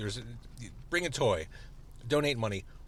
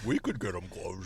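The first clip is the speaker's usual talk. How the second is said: lightly voiced dopey cartoon guy; a hint of a goofy cartoon; cartoon character voice; 4 semitones lower, 4 dB quieter than usual. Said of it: "like a cartoon character".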